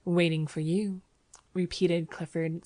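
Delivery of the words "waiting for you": ordinary delivery, nothing marked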